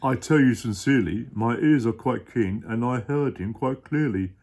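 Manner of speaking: low, loud gentle giant pleading; a big kind elephant voice, sure of himself; deep Horton voice, earnest. Acted. Dad as Horton explaining to his child.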